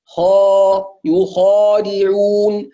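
خا and يخادعون with kh at its heaviest, slow and drawn out; heavy emphatic kha. Shown as a demonstration. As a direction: stretch يخادعون slowly, full heavy kh before the long a. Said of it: "المفتوح بعده ألف".

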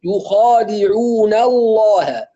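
slow recited يخادعون الله, light nun, heavy lam in الله; tajweed demo of the corrected phrase; correct recitation. Shown as a demonstration. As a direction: draw it out, keep نا light before الله. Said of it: "لكن اللام مغلظة يبقى نا".

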